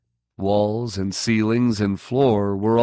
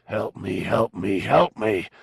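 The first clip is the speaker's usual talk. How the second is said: eerie chorus. A narrator's reading. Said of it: "all the voices chorused".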